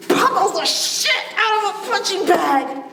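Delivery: ordinary talk, nothing marked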